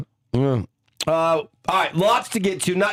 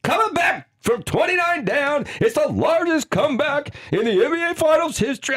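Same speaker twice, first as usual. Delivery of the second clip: excited; 12 semitones higher than usual. Real, not acted. Hyping record comeback on air.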